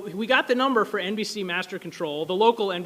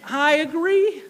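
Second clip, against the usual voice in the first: mock agreement; 8 semitones higher, 5 dB louder, much slower than usual. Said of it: "agrees with the Harvard chair".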